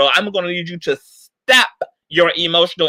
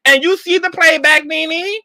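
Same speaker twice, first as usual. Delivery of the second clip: higher, pointed question to Nene; incredulous question; 10 semitones higher than usual. Real, not acted. Vlogger quizzing Nene on playback, incredulous. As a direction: ask it like you can't believe it. Asks Nene about her footage.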